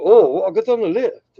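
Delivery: a quick lightly put-on British accent. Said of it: "I'm not doing an accent".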